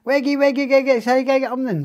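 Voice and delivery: high, loud gibberish chant; mock Eggy language. Imitating a prison mate's gibberish, high and loud. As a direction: squeal out silly made-up words. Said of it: "He started talking Eggy language".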